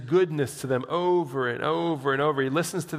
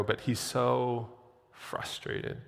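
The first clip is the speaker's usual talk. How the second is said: sighed frustration; 2 semitones lower, 6 dB quieter, much slower than usual. Acted. Preacher acting out Asaph's weariness, sighing softly. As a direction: fade to a breathy sigh on frustrated. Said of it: "sighing frustration".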